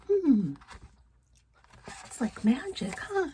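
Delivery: soft whisper full of wonder; storyteller marvelling softly to the children. A narrator's reading. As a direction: whisper it like a secret. Aside to listening children.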